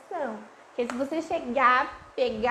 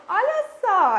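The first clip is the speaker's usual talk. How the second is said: higher, louder toddler voice imitating mom; lightly imitated toddler voice; little girl reading; 8 semitones higher, 6 dB louder than usual. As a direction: play a two-year-old reading to her dolls. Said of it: "ela vai fazer".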